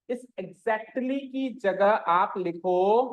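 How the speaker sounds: stretched-out, deliberate dictation pace; slow dictation. Shown as a demonstration. Teacher telling students what to write, slow dictation. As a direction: draw it out for pupils writing along.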